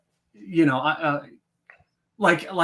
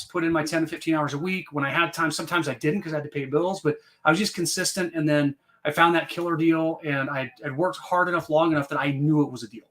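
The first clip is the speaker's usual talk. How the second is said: usual speaking voice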